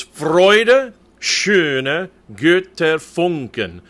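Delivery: Freude, schöne, Götterfunken in slow, firm, drawn-out German; slow diction model. Shown as a demonstration. Choir coach reading the Beethoven text to basses, slow careful diction. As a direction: model each German word slowly and separately. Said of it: "I'll be doing some reading of the text".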